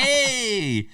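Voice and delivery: high, drawn-out hey; retold delighted cry. Uncle reacting to niece's baking-show word, mock delighted cry. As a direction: cheer it way up high, playfully.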